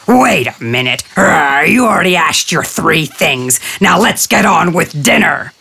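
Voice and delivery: a hungry tiger cutting in; impatient tiger. Acted. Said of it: "hungry and impatient, interrupted".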